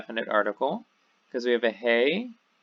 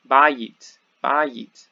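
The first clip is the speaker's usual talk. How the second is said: bayit said louder with BA stressed, falling; stress on first syllable; 8 dB louder than usual. Shown as a demonstration. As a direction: hit BA in bayit, then let it drop. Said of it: "the accent is here".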